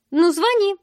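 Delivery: high, loud challenge; brash go-ahead. A narrator's reading. Woman lets the heroine phone Mark, brash and challenging. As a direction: dare her to go ahead.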